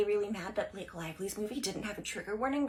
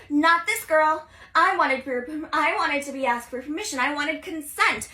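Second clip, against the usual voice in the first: louder, higher firm protest; indignant insistence; 4 semitones higher, 7 dB louder than usual. Retold or imitated. Girl telling her crush she wanted consent, loud and firm. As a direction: plant your feet and demand it.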